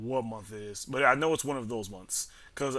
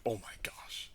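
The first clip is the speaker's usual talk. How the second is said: hushed, exasperated oh my gosh; breath-only mock exasperation; whispered dismay; 3 semitones lower, 11 dB quieter than usual. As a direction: imitate a teacher whispering in disbelief. Teacher's dismayed reply, quoted.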